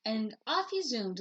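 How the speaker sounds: usual speaking voice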